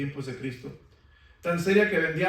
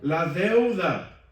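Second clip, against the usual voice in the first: slow emphasis; 5 dB louder, much slower than usual. Acted. Preacher repeats slowly.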